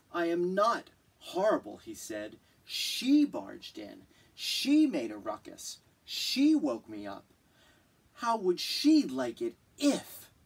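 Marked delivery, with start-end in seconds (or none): 0.1-1.8 loud, offended protest; indignant. Acted. The bear protesting the girl's insult, indignant and loud. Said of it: "Bear was indignant". 2.6-10.3 loud, offended complaint; indignant rant. Acted. The bear complaining about the girl, loud indignant rant. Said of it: "Bear was indignant".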